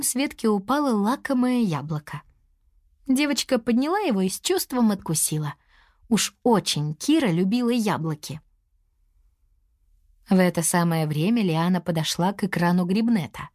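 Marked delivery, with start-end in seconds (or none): none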